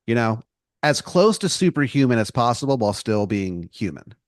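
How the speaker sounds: normal talking voice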